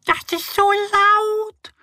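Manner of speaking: very high, slow squeaky scolding; scolding mice. Acted. Forest mice scold about the snoring boar, squeaky. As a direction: squeak out your complaint. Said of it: "schimpfen die Waldmäuse".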